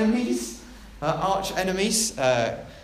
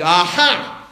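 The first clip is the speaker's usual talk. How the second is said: loud, sudden gotcha cry; shouting; 8 dB louder than usual. Acted. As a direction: shout it like catching someone out. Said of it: "shouted her neighbour".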